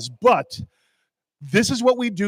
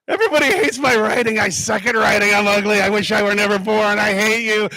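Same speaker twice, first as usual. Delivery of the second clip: put-on sulky teenager; 4 semitones higher than usual. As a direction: wail it like a moody fourteen-year-old. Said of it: "confidence of a fourteen-year-old".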